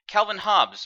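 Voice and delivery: Calvin Hobbs called out high and loud; excited announcer call. Acted. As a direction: hype the name Calvin Hobbs like a race caller. Announcer calls out driver name.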